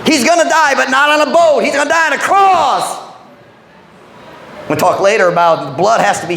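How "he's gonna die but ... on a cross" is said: preaching at full force. Acted. Pastor telling the church Jesus will die on a cross, preaching at full force. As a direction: ring out the climax.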